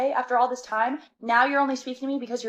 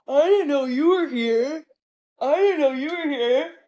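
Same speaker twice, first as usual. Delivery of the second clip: higher, slow, sing-song fake surprise; mock fake surprise; 5 semitones higher, 3 dB louder than usual. Mocking her ex's phony surprise at the airport. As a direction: mimic his phony innocent tone.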